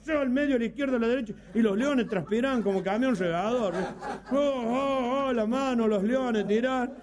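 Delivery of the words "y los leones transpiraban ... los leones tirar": normal talking voice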